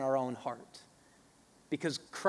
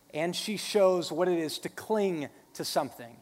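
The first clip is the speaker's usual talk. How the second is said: ordinary talk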